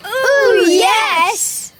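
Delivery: high, eager burst of delight; excited agreement. Acted. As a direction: jump in loudly with relish. Eager reply to suggested tale.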